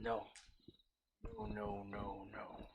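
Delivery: breathy whispered denials; whispering. Acted. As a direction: hush it out, scared. Captured goblin whispering denials.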